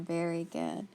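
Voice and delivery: normal talking voice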